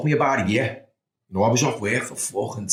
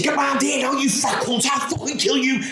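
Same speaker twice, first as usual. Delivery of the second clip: high, angry mock yell at the copper; re-enacting a furious dream threat, pitched high; retold scream; 12 semitones higher than usual. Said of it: "and I'm screaming".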